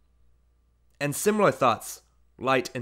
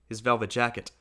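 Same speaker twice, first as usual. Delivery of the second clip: ordinary delivery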